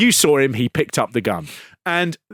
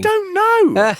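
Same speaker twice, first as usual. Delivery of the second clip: mock squeak; 3 dB louder than usual. Mock reply in high voice.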